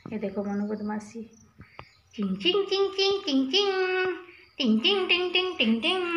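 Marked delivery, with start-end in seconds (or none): 2.1-6.0 put-on high, sing-song "Ching Ching" for the baby; playful baby-play chime noises. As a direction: chime like a toy to make the baby laugh. Playful sounds to amuse baby. (बच्चे को हँसाने के लिए ऊँची खिलंदड़ी आवाज़)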